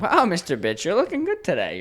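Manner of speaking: high, bright mock flattery; fake sweet compliment. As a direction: gush over him with fake sweetness.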